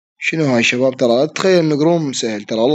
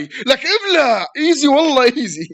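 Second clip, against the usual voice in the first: gloating; 11 semitones higher, slower than usual. Real, not acted. Gloating after a kill.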